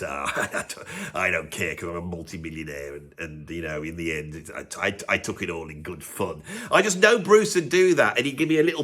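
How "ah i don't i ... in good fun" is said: very low, soft, easygoing impression; chummy murmur, far under your own pitch. Rock star forgiving the critic who mocked him, low chummy impression. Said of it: "he would be so chummy".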